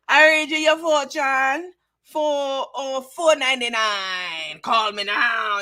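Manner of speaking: drawn-out fake Jamaican psychic sales pitch; Miss Cleo. Host mimics a TV psychic's ad, hammed-up mock accent. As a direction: stretch it slow like a phony TV fortune teller. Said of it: "with that fake-ass Jamaican accent".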